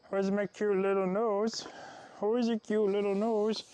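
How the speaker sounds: high slow cooing. Real, not acted. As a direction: coo over the pet's nose. Baby talk to pet.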